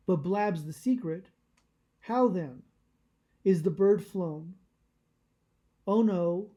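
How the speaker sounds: measured, projected reading with pauses between phrases; formal literary reading. Acted. Meditation host reads Emerson to listeners, formal literary reading.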